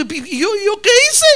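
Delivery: high, startled cry of innocence; panicked high-pitched protest; frightened plea. Acted. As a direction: beg like a man about to be killed. Daniel pleading facing the sword.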